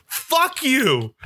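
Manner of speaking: high, heated cursing at the makers; furious rant. Real, not acted. Host ending his Star Wars rant, furious and high. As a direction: spit the curses out in outrage. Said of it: "End of rant".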